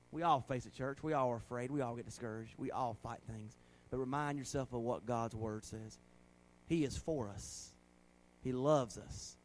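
low and soft pastoral reassurance; hushed, earnest preaching. Real, not acted. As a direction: bring it down, gently assuring the church. Softens to close the sermon.

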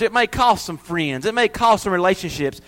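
ordinary talk

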